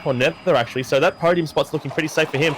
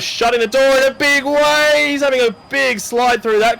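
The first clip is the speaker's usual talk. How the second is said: excited call; 8 semitones higher, 4 dB louder than usual. Real, not acted. Heated race moment.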